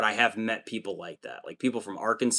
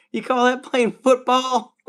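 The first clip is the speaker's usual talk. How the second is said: much higher, louder mock mom yelling at the TV; mom yelling at football; 14 semitones higher, 6 dB louder than usual. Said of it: "I could see your mom being like".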